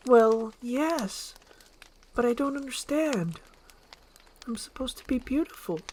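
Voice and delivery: high, puzzled voice; lightly voiced, bewildered princess; confused Fiona. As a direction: wonder aloud.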